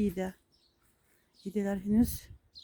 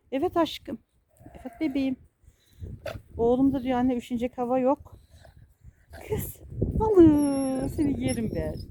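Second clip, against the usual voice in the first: put-on baby talk to pet; 5 semitones higher, 7 dB louder than usual. Coos at goats, kids.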